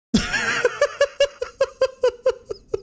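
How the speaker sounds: high, breathless cackle that won't stop; helpless high wheeze of laughter; wheezing laughter fit. Real, not acted. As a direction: laugh until you can barely breathe. Laughing at gnome joke.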